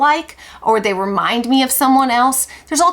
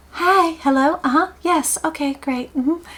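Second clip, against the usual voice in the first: Southern mom phone voice; 6 semitones higher than usual. Retold or imitated. Southern mom answering the ringing phone, putting on her high phone voice. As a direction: switch to your sweet Southern-mother phone manner. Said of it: "That's the Southern mom phone voice".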